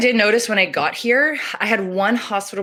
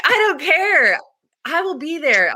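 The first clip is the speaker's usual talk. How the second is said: excited; 8 semitones higher, 6 dB louder than usual. Retold or imitated. Excited retold reply to client.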